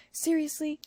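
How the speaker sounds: high, pointed disbelief; stern question. A narrator's reading. Narrator sternly questioning Shigure. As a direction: call him out on it. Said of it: "I questioned him sternly".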